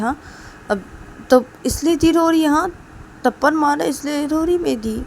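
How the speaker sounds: a younger brother raising his voice in shock; indignant outburst. Acted. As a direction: sputter it out in high disbelief. Rahul reacts, sister crying.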